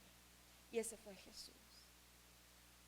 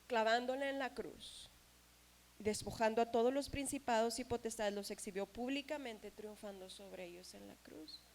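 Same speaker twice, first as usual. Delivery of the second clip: scripture reading; 6 dB louder than usual. Acted. Reading scripture verse aloud.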